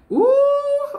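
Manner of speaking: sudden high squeal of surprise; surprised ooh. Real, not acted. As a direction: jumps way up in pitch, caught off guard. Stumbles on a Bible book name.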